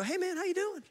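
very high, singsong hello; imitated over-friendly greeting; friendly retold greeting. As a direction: put on a light, high chummy greeting. Retells greeting the stranger.

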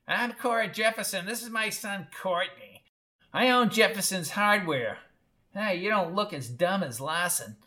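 high, scratchy mother's voice; high-pitched, tactless old storekeeper; brusque screechy old woman. A narrator's reading. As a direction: introduce yourself bluntly in a screech.

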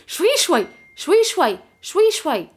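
high-pitched, repeated falling pleas; imitated panicky sister. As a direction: mimic someone falling apart, begging for care. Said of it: "وهي منهارة تقول لي".

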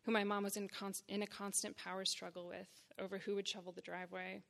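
usual speaking voice

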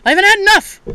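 high, loud, jokey put-on voice; joking dad voice. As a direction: ham up the dad's joke, pitched high. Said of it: "And he's like".

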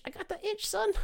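I got the itch jumps way up, squeaky and soft; squeaky high repeat. Pretend. Craving nicotine, squeaking his confession high.

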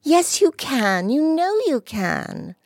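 put-on high, slow insistent girl's voice; high girlish insistence; impulsive little girl. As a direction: blurt it out on impulse. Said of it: "said Dulcie impulsively".

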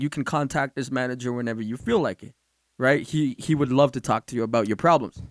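low, soft, composed politeness; deadpan mock courtesy. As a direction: hand over the card with straight-faced courtesy. Retold deadpan prank on customer.

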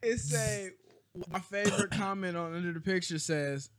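ordinary talk, nothing marked

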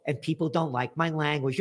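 normal talking voice

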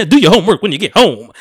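loud, high scolding through laughter; laughing parent voice. Retold or imitated. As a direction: nag the kid while cracking up. Continuing the Family Guy bit.

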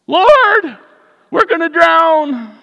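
high, loud panicked plea; frightened cry. Retold or imitated. Disciples crying to Jesus in fear, high and loud. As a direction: beg in terror. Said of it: "they were scared".

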